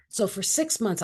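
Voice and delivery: ordinary talk, nothing marked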